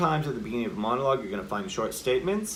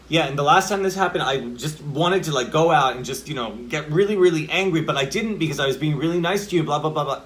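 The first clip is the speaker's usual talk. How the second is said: louder, higher, fast heated monologue; emotion building; 4 semitones higher, 6 dB louder than usual. Shown as a demonstration. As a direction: let the demonstrated upset build and spill out quickly. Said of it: "the emotion builds in a monologue".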